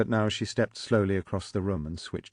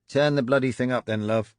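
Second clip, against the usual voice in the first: gruff instruction; 5 semitones higher, 7 dB louder than usual. Acted. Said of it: "he instructed".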